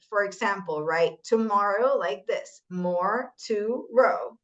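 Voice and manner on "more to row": slow "more... to... row", each syllable standing alone; separated syllables. Shown as a demonstration. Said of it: "with a syllable separate".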